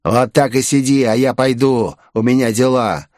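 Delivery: put-on villain voice, mildly mocking; creepy bandit. A narrator's reading. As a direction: brush her off as the gloating bandit. Same bandit taunts Victoria. (насмешливый голос бандита)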